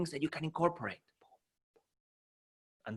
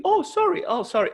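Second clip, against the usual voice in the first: loud, high squeaky cartoon apology; Mickey Mouse; 6 dB louder than usual. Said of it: "like Mickey Mouse".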